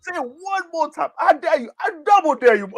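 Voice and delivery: Samuel L. Jackson impression. Mocking the Boondocks character daring someone, hammed-up high dare. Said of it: "When he voiced the character".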